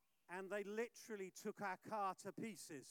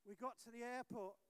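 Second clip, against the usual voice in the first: usual speaking voice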